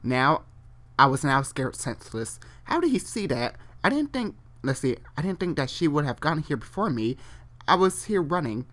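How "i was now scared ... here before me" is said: ordinary talk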